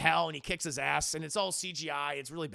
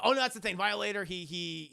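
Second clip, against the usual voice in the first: normal talking voice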